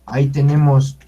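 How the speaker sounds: ordinary talk, nothing marked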